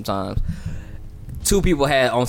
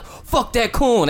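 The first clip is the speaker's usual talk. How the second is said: mock angry crowd; 11 semitones higher, much slower than usual.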